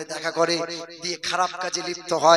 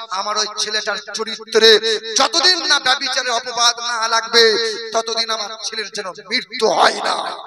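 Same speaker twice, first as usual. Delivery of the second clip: loud, high wailing plea that breaks into a shouted cry; a mother's wailing, shouted lament to God; 6 semitones higher, 8 dB louder than usual. Acted. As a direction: cry out to the Lord in grief and anger. Mother's crying complaint to God.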